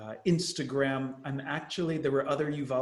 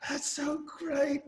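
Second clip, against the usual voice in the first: gushing American tourist; 14 semitones higher, slower than usual. Retold or imitated. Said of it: "I was like".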